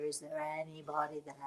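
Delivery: low, hushed medium calling to the spirits; eyes half closed, reach out to the other side; seance medium. Shown as a demonstration. Medium at a seance asking the spirits, low and hushed. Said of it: "and then the medium would say".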